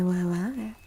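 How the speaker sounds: ordinary delivery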